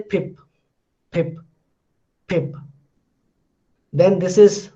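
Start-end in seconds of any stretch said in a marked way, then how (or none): 0.0-2.6 PIP said on its own as a clear model word; careful teacher's "pip"; model pronunciation. Shown as a demonstration. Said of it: "how will you say".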